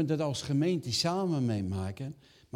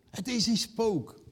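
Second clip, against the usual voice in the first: frightened cry; 9 semitones higher, slower than usual. Acted. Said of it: "En weet je wat ze roepen?".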